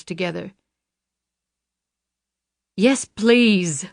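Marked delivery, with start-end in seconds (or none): 2.8-3.9 tight, strained plea, a bit higher; strained please. A narrator's reading. Luann forced to say please to Jackson, strained. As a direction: swallow her pride and plead.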